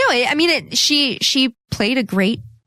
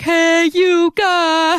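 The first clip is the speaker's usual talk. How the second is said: long drawn-out, high movie-quote yell; imitated Goonies holler; 10 semitones higher, 4 dB louder, much slower than usual. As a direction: stretch every word out as a high holler. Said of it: "that Goonies line ... and he's like".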